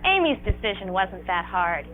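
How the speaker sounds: ordinary talk, nothing marked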